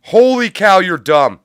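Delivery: loud, high outburst of contempt; shouting at the people on screen; angry shout. Real, not acted. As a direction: bark the insult at the crowd. Yelling at New Yorkers.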